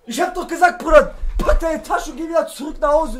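ordinary talk, nothing marked

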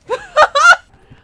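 high, loud nervous laugh; giddy scared laughter; shrieking laugh. Real, not acted. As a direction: laugh out the fright in a squeal. After the scare.

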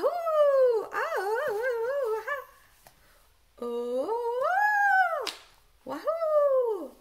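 high, loud joyful whoops; excited whooping vowels. Shown as a demonstration. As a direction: cheer the sounds out. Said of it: "look at this page".

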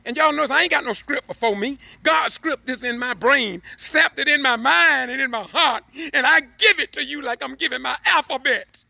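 carried away mid-sermon, pitch climbing fast; emotional preaching. Real, not acted. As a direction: pour it out as the spirit rises. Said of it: "for getting emotional".

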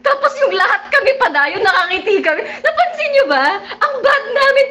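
high, loud laughing confession. Real, not acted. Vlogger confessing the team smiled at Kath, laughing. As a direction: giggle it out high.

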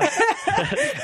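bright high burst of laughter; real amused laugh. Real, not acted. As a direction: laugh out loud, delighted. Host laughs at easy interview.

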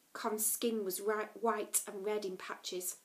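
plain reading voice

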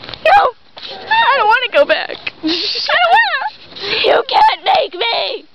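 put-on high, slow bratty child yelling no; whiny child refusal. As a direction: stamp your foot like a kid who won't go home.

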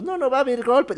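high mock-weepy voice; imitated tearful ladies. Old ladies crying in the street, mock tearful. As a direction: imitate women wailing lightly. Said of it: "Algunas señoras estaban llorando".

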